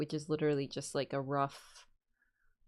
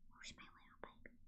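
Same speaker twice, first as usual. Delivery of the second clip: whisper; 27 dB quieter than usual. Real, not acted. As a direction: gasp then very soft whisper. Whispering to the cat.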